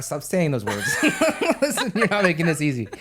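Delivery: helpless giggles breaking up the words; laughing protest. Real, not acted. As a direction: plead for seriousness while cracking up. Cracking up at innuendo.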